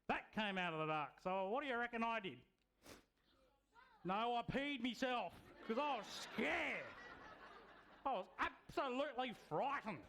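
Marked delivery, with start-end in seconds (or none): none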